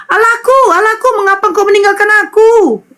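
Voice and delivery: high-pitched mock cry, not truly shouted; Yesus, retold cry. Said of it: "kenapa dia mesti teriak". (seruan tiruan bernada tinggi)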